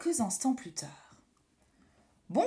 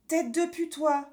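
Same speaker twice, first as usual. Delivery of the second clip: put-on higher rude little boy; a naughty child blurting an insult; cheeky kid voice; 5 semitones higher than usual. A narrator's reading. Pierre insulting doctor.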